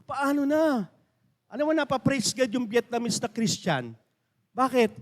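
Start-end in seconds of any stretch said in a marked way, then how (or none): 0.0-0.9 slow, drawn-out mock cry; acted crying lament, dragged out; imitated tearful prayer. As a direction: plead helplessly, as if weeping. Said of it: "At iyak siya".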